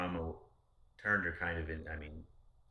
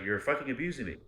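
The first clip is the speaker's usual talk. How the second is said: higher and louder mock yell; retelling a fight with his ex, a higher, louder mock yell; retold scream; 8 semitones higher, 7 dB louder, faster than usual. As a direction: shout it back as if it is happening again.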